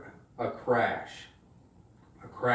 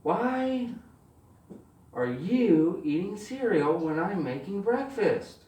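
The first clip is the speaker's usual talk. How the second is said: higher-pitched mock-stern mom, word by word; exasperated mom; 5 semitones higher, 4 dB quieter, slower than usual. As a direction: imitate a mother's slow, drawn-out questioning. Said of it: "She says".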